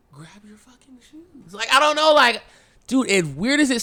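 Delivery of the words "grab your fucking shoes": very soft, slow and drawn out; hushed retelling. Retold or imitated. Storyteller recalls the weird thing you do, hushed and slow. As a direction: barely voice it, dragging the words. Retelling his own odd reaction.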